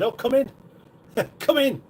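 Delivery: high, bright sing-song welcome; chirpy hostess greeting; mock sing-song welcome. Imagined welcoming someone in.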